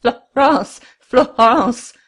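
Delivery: loud, high shaken calling; stammering cry. A narrator's reading. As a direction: cry out her name, stunned.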